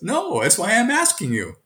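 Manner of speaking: a hint of an honest kid; student answering. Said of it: "He goes".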